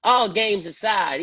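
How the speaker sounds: a director friend telling him to stop playing; serious friend. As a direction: cut the joking and get serious. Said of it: "He's like".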